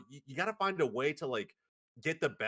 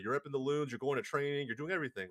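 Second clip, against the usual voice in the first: usual speaking voice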